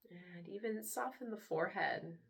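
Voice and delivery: soft, unhurried meditation voice with long pauses; guided meditation. Acted. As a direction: hush down and gently lead them into relaxation. Leads a guided relaxation.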